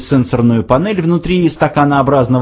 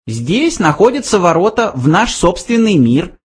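high little mouse voice; interjecting mouse; 8 semitones higher than usual. A narrator's reading. Mouse adds a detail, high mouse voice. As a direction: slip it in, pitched high. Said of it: "вставил Бенджи".

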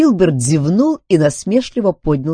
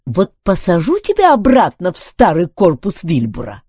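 firm threat, raised pitch and volume; threatening; 6 semitones higher, 5 dB louder than usual. A narrator's reading. Duncan threatens Filbert with the old body, warning. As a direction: warn him off, louder. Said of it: "пригрозил Дункан".

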